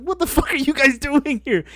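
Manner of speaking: high, laughing outcry; retold horrified disbelief. Recruit to the blood-draw staff, high laughing disbelief. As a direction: exclaim it, cracking up.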